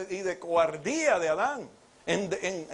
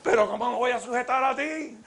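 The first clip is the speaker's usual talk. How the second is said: acted marital quarrel; 5 semitones higher than usual. Husband and wife quarrel acted.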